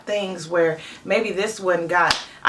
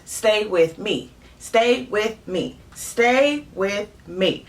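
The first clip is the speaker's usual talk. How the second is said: slow, deliberately spaced words; a parent's slow, emphatic repeated instruction; drilling a rule; 2 semitones higher, much slower than usual. Acted. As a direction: drill it in slowly, word by word.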